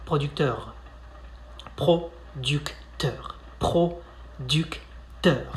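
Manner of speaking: "Producteur" stretched out syllable by syllable; slow pronunciation drill. Shown as a demonstration. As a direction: break it down slowly. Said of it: "spitting something out".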